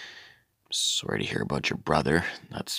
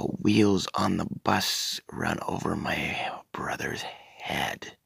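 breathy whisper, half-sung; whispered verse; 5 dB quieter, slower than usual. Pretend. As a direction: hush it like a dark secret.

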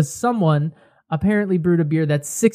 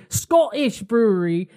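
put-on higher, botched Scottish accent; attempted Scottish accent; 5 semitones higher than usual. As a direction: have a bad go at Scottish, drifting towards British. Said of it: "That might have been British".

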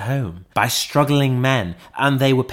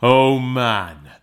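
slightly louder spirit's cry; ghost's exclamation; 3 dB louder than usual. A narrator's reading. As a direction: call out to man in urgent appeal. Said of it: "exclaimed the ghost".

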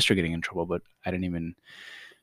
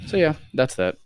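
ordinary talk, nothing marked

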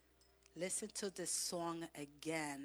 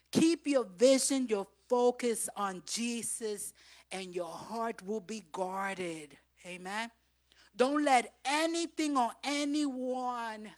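loud, high exhortation; full-force preaching; 4 semitones higher, 11 dB louder than usual. Acted. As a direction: preach it hard to the church.

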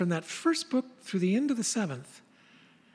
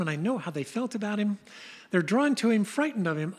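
usual speaking voice

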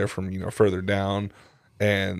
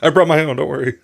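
laughing; 6 semitones higher, 6 dB louder than usual. Pretend. Mock reply, louder.

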